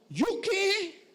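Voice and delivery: the devil playing innocent; high hammed-up devil; indignant devil.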